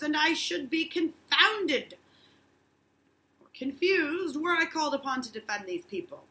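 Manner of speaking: lecturing judge. Acted. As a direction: lecture the bewildered minister. Danforth lectures Hale.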